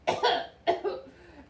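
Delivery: harsh hacking cough; a loud rasping cough. A narrator's reading. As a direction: cough hard before speaking. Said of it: "he coughed and he whiffed".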